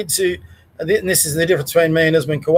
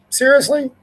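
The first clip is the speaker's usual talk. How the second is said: high scoffing disbelief; incredulous; 7 semitones higher than usual. Real, not acted. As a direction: sneer it in disbelief. Scoffing at quoted statement.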